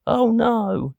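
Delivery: a cartoon victim's high wail; high panicked cry. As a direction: shriek as the skillet comes. Character sees skillet coming.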